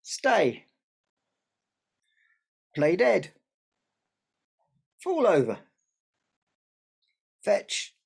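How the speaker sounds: loud, spaced-out commands to a pet; dog commands. Pretend. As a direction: order it like training a dog.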